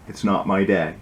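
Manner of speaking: ordinary delivery, nothing marked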